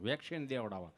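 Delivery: low, halting stammer; imitating a tongue-tied man; stammering friend. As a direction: stick on the words, tongue catching. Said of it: "જીભ જલાય, ચોંટે અટકે".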